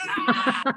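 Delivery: high startled shriek; high mock-scared shriek; startled scream. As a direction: yelp in shock at the voice beside you. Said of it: "I was like".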